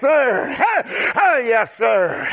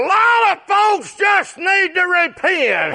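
preaching shout; 9 semitones higher than usual. Real, not acted. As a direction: lift it up and shout it to the congregation. Climax of the preaching run.

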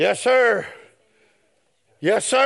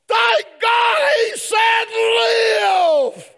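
whooping sermon peak; preaching at full force; 13 semitones higher, slower than usual. Real, not acted. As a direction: cry it out in ecstasy. Preacher shouts climax.

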